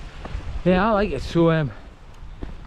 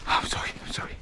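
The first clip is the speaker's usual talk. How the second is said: sheepish whispered sorry; whispered apology; 8 dB quieter, faster than usual. Real, not acted. Vlogger whispering sorry to viewers for the accent. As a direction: breathe the apology under your voice.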